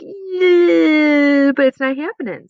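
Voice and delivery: high, loud mock yeah, then flat; sarcastic no. Shown as a demonstration. Teacher acts a sarcastic refusal, loud. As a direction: say it dryly, not going to happen. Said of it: "it's like".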